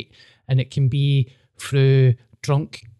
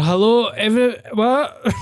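retold startled greeting; 8 semitones higher than usual. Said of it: "And I was pure".

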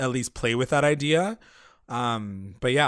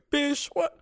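high, stunned mock outrage; imitating Madam Satan's high, shocked reaction; 9 semitones higher, 4 dB quieter than usual. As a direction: squeal it like you can't believe your eyes.

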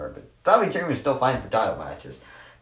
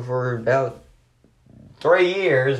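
drawn out, higher and louder, sarcastically; sarcastic exaggeration; 4 semitones higher, 4 dB louder, slower than usual. Real, not acted. Wrestling fan mocking how long Retribution lasted, sarcastic. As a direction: stretch it with mock emphasis. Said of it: "obviously, I'm being sarcastic".

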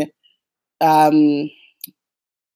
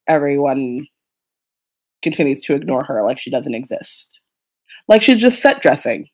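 normal talking voice

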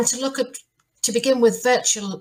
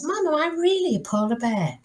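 higher-pitched, pleading little bear; questioning young bear; 5 semitones higher than usual. Acted. Polar bear asking his mum, doubtful questioning. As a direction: ask it up high, doubting yourself. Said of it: "goes to his mum and he says".

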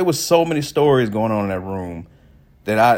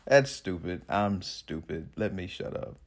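much softer, slow aside; embarrassed aside; 4 semitones lower, 10 dB quieter, slower than usual. Real, not acted. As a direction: mumble it to yourself. Embarrassed self-mocking aside.

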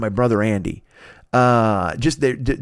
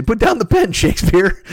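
studio exec; 8 semitones higher, 4 dB louder than usual. Retold or imitated. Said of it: "we say".

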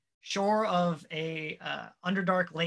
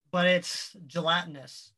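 ordinary talk, nothing marked

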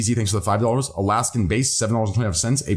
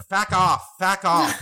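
higher-pitched go at an Aussie "fack off"; mock Australian accent; 6 semitones higher, slower than usual. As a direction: try imitating an Australian "fuck off". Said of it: "They're just saying fuck".

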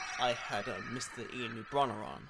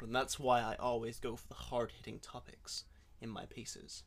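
put-on American accent, lightly done; podcast host faking an American accent; fake American accent. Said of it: "That sounds so American".

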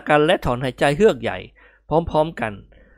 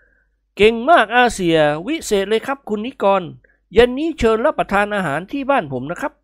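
higher, effusive official; admiring arena manager; 7 semitones higher than usual. Acted. Arena manager congratulating the two winners, effusive. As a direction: praise them heartily, then invite.

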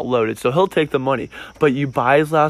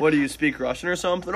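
ordinary talk

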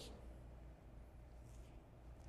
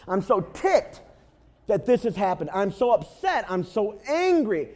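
louder, higher pretend complaining; hammed-up driver venting beside the broken Honda, louder; mock angry rant; 7 semitones higher, 7 dB louder, slower than usual. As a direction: whine about the car like it ruined everything. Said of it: "you got out and you said".

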